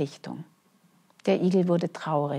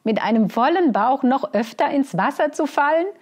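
laughing hare; 7 semitones higher, 6 dB louder than usual. Acted. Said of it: "lachte der Hase".

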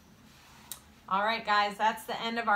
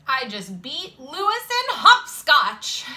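loud, higher-pitched boast; proud announcement; 5 semitones higher, 8 dB louder than usual. A narrator's reading. Girl boasting to friends about her hopscotch win, proud and loud. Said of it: "Jenny announced proudly".

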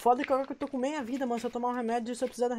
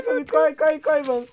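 ordinary delivery

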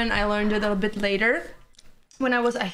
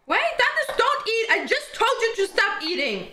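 much higher, urgent cry to stop; urgent shout; 10 semitones higher than usual. Real, not acted. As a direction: yell to stop him eating. Stopping him from eating.

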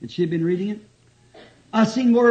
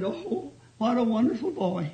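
soft mock-weepy old ladies; retold tearful gushing; 4 semitones higher, 5 dB quieter than usual. Old ladies admiring the young preacher, mock tearful. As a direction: lightly imitate ladies gushing through tears. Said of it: "they cried a little bit".

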